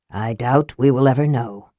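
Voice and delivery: low, lightly dismissive; dismissive shrug. A narrator's reading.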